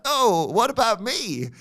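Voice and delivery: high slow put-on whine; teasing impression of a left-out friend; mock whiny friend. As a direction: stretch it out high and plaintive. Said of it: "Halfley would be like".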